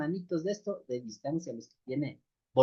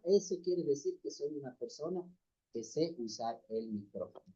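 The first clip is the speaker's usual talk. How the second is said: speaking far off the mic; 10 dB quieter than usual. Shown as a demonstration. Demonstrating to the brothers how mic distance sounds, far off and faint. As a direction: voice fading away from the microphone. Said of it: "Si es que hablo de esta forma".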